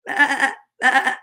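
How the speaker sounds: wavering double baa; sheep bleat. Acted. Storyteller who loves sheep, bleating baa. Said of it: "says a sheep".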